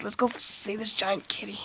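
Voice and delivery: hushed whisper. Acted. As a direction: whisper while sneaking closer. Sneaking up to the kitty.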